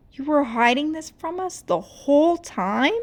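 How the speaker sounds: high, loud hurt question; betrayed by a friend; shaking voice. Acted. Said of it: "Mira's voice shook".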